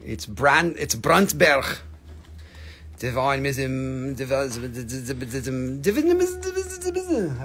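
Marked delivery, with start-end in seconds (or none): 5.8-7.4 high buzzing run of gibberish syllables; silly high-pitched babble; nonsense stammering. Pretend. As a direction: garble the name into a high stutter. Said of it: "okay, I'm gonna stop".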